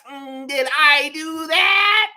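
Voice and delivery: slow, nerdy sitcom voice; Steve Urkel. Retold or imitated. Mocking the Raptors' annoying style, slow nerdy voice. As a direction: drag it out like the sitcom nerd. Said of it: "You got Steve Urkel".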